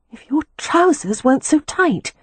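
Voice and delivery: high, teasing protest; protesting woman. A narrator's reading. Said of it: "she protested".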